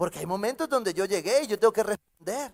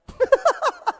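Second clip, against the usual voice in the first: high laugh; 3 dB louder than usual. Real, not acted. High laugh after the joke.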